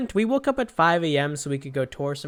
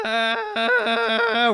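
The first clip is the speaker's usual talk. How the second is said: a sudden high moaning "ah" from half-asleep; sleepy moan; 5 dB louder than usual.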